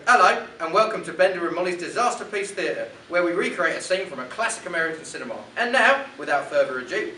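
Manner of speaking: put-on loud, deliberately awful English accent. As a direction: ham up a terrible cockney English accent. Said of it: "guy from Mary Poppins that has the shit accent".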